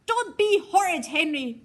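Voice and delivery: high, loud scolding shout; angry shout. A narrator's reading. Mum shouting at Henry for jumping at dog, angry. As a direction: snap at him in anger. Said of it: "she shouted".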